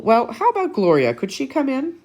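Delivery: a touch of a cheerful principal; bright, hopeful principal. Acted. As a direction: perk up with a hopeful idea. Mrs Topple asks about Gloria.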